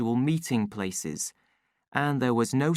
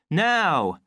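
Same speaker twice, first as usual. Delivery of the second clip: raised, loud word of command; 6 semitones higher, 5 dB louder than usual. A narrator's reading. Young official to the waiting crowd, commanding. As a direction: call the crowd to order. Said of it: "he commanded".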